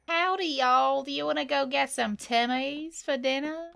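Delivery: playful fake Southern American accent inviting friends out; mock Southern American accent. Shown as a demonstration. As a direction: play a cowboy from the American South, half-joking. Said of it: "Do more American accent".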